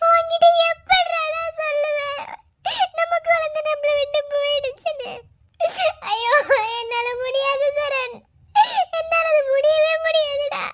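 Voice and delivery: slow, high, loud weeping; tearful lament. Acted. Wife laments she cannot tell her husband, slow weeping. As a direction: wail each line through the tears.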